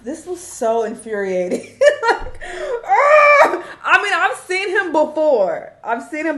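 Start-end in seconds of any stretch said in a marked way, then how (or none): 2.1-3.6 a high, loud burst of laughter that breaks into a wail; exasperated laugh-cry. Real, not acted. Reacting to the [name] clip, a high exasperated laugh-cry.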